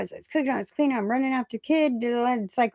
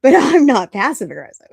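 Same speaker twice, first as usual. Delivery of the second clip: loud, joking denial breaking into laughter; amused, emphatic self-defense; laughing comeback; 3 semitones higher, 9 dB louder than usual. Retold or imitated. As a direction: punch out the retort with a laugh. Retelling her joking comeback, laughs.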